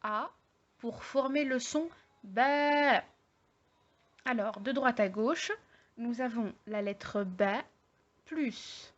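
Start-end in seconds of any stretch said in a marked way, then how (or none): 2.2-3.0 ba with the vowel held long on a raised, level pitch; drawn-out, higher ba. Shown as a demonstration. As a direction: stretch the a in ba out slowly. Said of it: "la voyelle longue avec le son".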